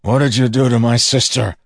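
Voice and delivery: loud, high, forceful demand. A narrator's reading. Brother confronts his sister's supposed captor, loudly demanding. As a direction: shout the question so it carries. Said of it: "Wax demanded".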